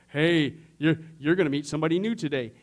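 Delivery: loud, hint of psychic prediction; mock psychic. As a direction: proclaim it like a phoney fortune-teller. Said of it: "one of those psychic things".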